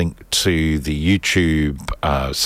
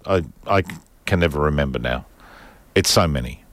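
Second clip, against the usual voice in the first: ordinary delivery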